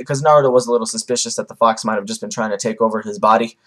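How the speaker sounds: ordinary talk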